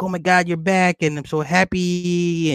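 high-pitched mock-happy dad; gushing dad. Dad welcomes his daughter back, gushing in a high voice. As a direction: gush in a high voice, drawing out the end.

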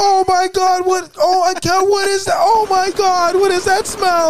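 high, loud mock lady disgust; disgusted by smell. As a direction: squeal like she smells something awful. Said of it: "I just hear this lady go".

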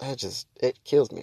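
normal talking voice

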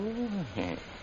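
short high sly chuckle. Acted. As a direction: chuckle slyly at the news. Sly laugh at rival's fall.

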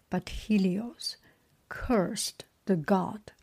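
slow, measured storybook reading; story narration. A narrator's reading. Reader telling the myth of Phaeton, measured story narration. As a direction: read it out phrase by phrase, with pauses.

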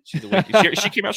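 ordinary talk, nothing marked

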